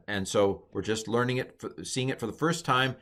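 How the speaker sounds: ordinary talk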